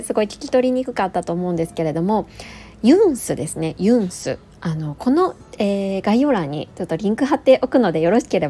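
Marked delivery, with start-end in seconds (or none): 2.8-4.4 ユンス said loud and distinctly, word set apart; clear enunciation. Shown as a demonstration. Host repeating ユンス after being hard to catch, clear enunciation. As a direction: pronounce ユンス carefully so listeners can catch it. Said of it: "発音が悪くって、".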